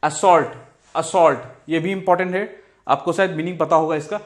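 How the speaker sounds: ordinary delivery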